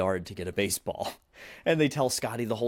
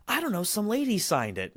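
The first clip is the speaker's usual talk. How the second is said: put-on high, innocent kid voice; Scotty answering the boys, high and naive; clueless kid; 10 semitones higher than usual. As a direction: play the clueless new kid.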